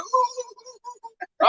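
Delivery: high soft imitated whimper like a little kid; mock whimper. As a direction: whimper like a crying child. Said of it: "in the bathroom crying".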